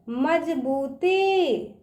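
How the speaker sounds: very slow मजबूती, बू held long; drawn-out long ū. Shown as a demonstration. Teacher drilling children, मजबूती with drawn-out ū. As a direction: stretch out बू in मजबूती. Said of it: "इसका उच्चारण ना खींच के करते हैं".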